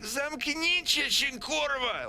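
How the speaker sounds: very high-pitched yell in Polish; exasperated high outburst; shut-up outburst. Acted. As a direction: snap at them to shut up. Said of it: "tells him, Shut the fuck up".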